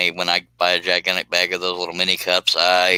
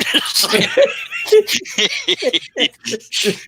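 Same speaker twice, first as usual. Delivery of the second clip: big laugh. Real, not acted. Laughing at own joke.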